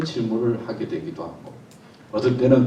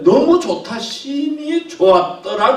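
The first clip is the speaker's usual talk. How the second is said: much higher and louder, drawn out; joyful exclaim; 10 dB louder, slower than usual. Shown as a demonstration. God making humans, soaring joyful cry. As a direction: sing out the praise in delight. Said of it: "웃음이 나는 거예요".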